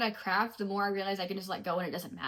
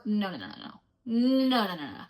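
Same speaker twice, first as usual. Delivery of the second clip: emphatic no. Real, not acted. Emphatic refusal.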